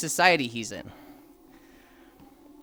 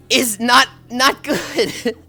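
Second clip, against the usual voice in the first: louder, insistent not good; emphatic disbelief; 4 semitones higher, 6 dB louder, much slower than usual. Real, not acted. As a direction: lean hard on the not. Outraged emphasis on slavery point.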